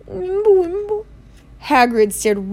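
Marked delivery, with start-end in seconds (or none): 0.1-1.0 tiny, very high wordless squeak; frightened mumble. A narrator's reading. Pale Uncle Vernon cowed by Hagrid, frightened mumbling. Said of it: "whispered something that sounded like".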